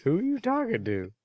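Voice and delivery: high teasing laugh. Real, not acted. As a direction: laugh while teasing him. Teasing friend about text.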